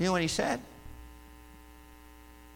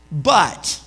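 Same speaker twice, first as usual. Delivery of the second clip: slow, solemn reading of the psalm, well above his usual level; scripture reading; 5 dB louder, slower than usual. Acted. Pastor reading David's confession to the church, slow and solemn. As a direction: read it like a solemn lament.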